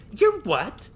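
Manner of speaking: high, loud shocked exclamation; shocked cry. Acted. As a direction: gasp it out in disbelief. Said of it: "Tori cried".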